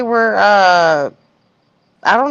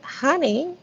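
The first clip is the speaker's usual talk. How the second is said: imitating Shirley; 7 semitones higher, 3 dB quieter than usual. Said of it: "she's like".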